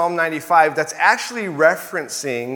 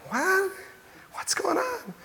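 jumping much higher, then a soft unvoiced whisper; bewildered; 9 dB quieter than usual. Pastor voicing his own stunned reaction to the complaint. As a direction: act baffled, as if caught off guard. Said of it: "And you go".

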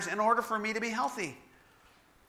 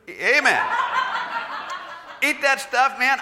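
laughing; 7 semitones higher, 6 dB louder than usual. Real, not acted. Joking about loving junk food.

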